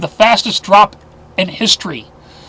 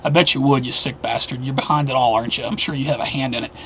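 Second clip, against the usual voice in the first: menacing low taunt; 6 semitones lower, 7 dB quieter than usual. Real, not acted. Host taunting Bill Gates, softly accusing. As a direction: drop it soft and accusing, like a quiet threat.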